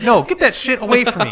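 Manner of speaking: high-pitched, exasperated refusal; indignant mock rejection. Country fan rebuffing a [name] suggestion, mock-indignant. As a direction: push it away in mock disgust, voice jumping up.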